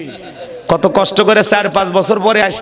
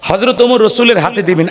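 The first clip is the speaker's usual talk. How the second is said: ordinary talk, nothing marked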